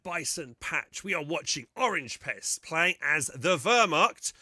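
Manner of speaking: bright, high caster welcome; caster intro. Acted. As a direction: open the show like a broadcaster. Caster opens the broadcast.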